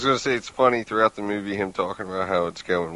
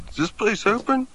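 high mock voice; 12 semitones higher, 4 dB quieter than usual. Sailors asking, quoted comically.

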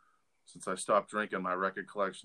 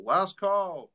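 high, loud drawn-out call; bartender's yell; 11 semitones higher, 6 dB louder than usual. Retold or imitated. Bartender to drinkers at closing time, yelling. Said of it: "The bartender would yell".

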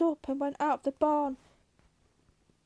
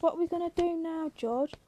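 raised pitch, quick anxious question; 4 semitones higher than usual. A narrator's reading. Candy asks George what happens next, anxious. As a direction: ask it anxiously, a little high and quick.

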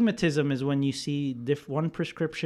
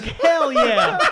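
high, loud and laughing; reliving a proud, triumphant moment; retold proud elation; 10 semitones higher, 7 dB louder than usual. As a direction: crow it like a flattered dad. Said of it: "I felt so good".

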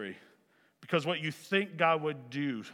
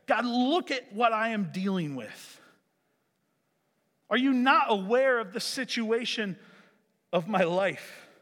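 raised-pitch, exasperated protest; upset with God; 6 semitones higher, 3 dB louder than usual. Acted. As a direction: complain upward like a wronged, pouting man. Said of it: "he's upset with God, and he's like".

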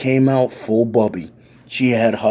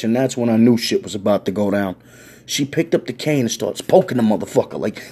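ordinary delivery

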